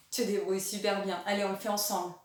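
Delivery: ordinary delivery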